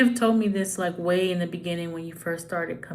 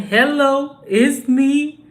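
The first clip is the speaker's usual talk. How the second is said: loud, higher [name] style "Hello, it's me"; [name] impression; 6 semitones higher, 9 dB louder, much slower than usual. Singing out [name]'s greeting to viewers loudly. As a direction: belt it out like [name]. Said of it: "That's [name], ain't it?".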